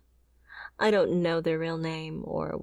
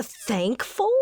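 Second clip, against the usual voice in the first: tearful disbelief; 4 semitones higher than usual. Acted. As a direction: voice cracking on a rising thankful?. Ex-bodyguard hurt by her employer.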